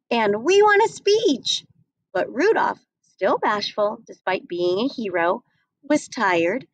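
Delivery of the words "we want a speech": excited screech. A narrator's reading. Crowd cheering Rudolph's landing, high excited screech. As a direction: cheer him like a thrilled crowd. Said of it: "then started to screech".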